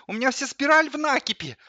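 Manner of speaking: high, loud squealing complaint. A narrator's reading. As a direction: wail about it like a frantic kettle. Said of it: "заверещал чайник". (визгливо, с возмущением)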